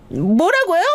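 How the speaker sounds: high, rising-pitched cry of disbelief; shocked. A narrator's reading. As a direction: blurt it as the stunned wife, voice shooting up. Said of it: "아내는 너무 놀란 얼굴로". (깜짝 놀라 높게 되묻는 목소리)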